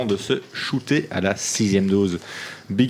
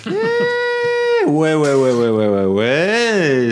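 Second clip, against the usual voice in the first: jingle voice; 4 semitones higher, much slower than usual. Acted. Mock radio jingle voice.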